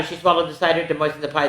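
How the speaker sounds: ordinary delivery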